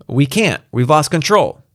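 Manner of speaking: high-pitched, urgent report; urgent pilot. Acted. Pilot's desperate reply.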